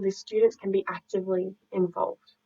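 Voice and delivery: ordinary talk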